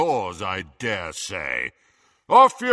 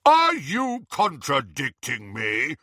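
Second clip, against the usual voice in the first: slow, indignant challenge; drawn-out, pompous outrage; blustering; 3 semitones higher, 4 dB louder, slower than usual. Acted. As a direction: bluster at an underling. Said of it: "Harry blustered".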